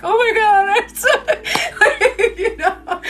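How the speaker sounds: high laughing overwhelmed squeal. Retold or imitated. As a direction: gush it through giggles. Friends' overwhelmed replies, laughing.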